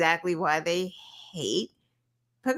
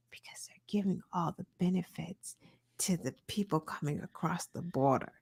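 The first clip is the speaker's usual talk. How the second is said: hushed whisper, imitating gossip; mock secretive whisper; 10 dB quieter than usual. Coworker's claim voiced secretively.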